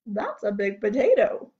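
low-pitched farmer's voice. Acted. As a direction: drop the pitch to play the farmer. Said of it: "said the farmer".

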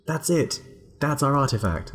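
high, excited realization; excited surprise. Real, not acted. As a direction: gasp then delighted exclamation. Sudden discovery of the artifact.

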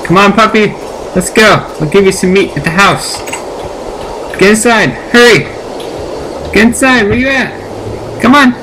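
high, coaxing pet-talk call; calling the dog home in a high voice. Real, not acted. Calling the puppy inside.

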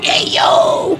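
sudden high unvoiced shout. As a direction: yell it out high. Guys shouting at the story.

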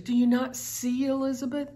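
usual speaking voice

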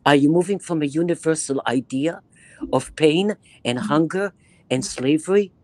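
ordinary talk, nothing marked

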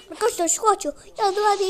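frantic call to the viewers; excited calling out. Real, not acted. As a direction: shout for help as he chases. Chasing someone in game.

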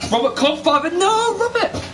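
high-pitched cry of dismay; dismayed outcry. Real, not acted. Commentator reacts to Robert's time penalty, dismayed outcry.

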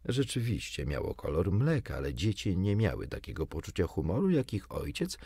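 ordinary delivery

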